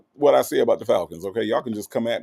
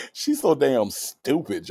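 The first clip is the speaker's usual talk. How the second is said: ordinary talk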